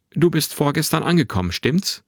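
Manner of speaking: put-on high, clear young boy; clear, bright boy's voice. A narrator's reading. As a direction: lift it into a bright, boyish question. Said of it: "mit einer klaren, hellen Stimme".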